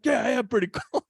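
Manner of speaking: high, quick, half-laughing quote; fast high-pitched mock concession; mock admission. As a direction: imitate him blurting it out, amused. Driver quote, laughing through it.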